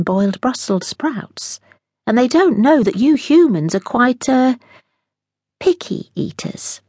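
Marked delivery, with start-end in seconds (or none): 5.6-6.8 wry aside. A narrator's reading. Narrator sly aside.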